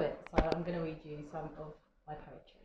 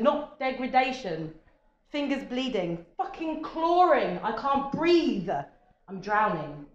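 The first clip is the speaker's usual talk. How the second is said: punchy short phrases, building in intensity; spoken-word poem; 5 semitones higher, 9 dB louder than usual. Acted. Poet performs Drown to audience, intense spoken word. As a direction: build line by line, then drop on the last.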